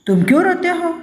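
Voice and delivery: scolding. A narrator's reading. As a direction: scold the crying child sharply. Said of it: "कहती है डांट कर". (डांटते हुए ऊँची आवाज़ में सवाल)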